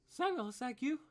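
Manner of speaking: put-on high, loud surprised girl's voice; surprised daughter. Daughter surprised to find her father back, high and loud. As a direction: gasp out the name in disbelief. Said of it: "She says".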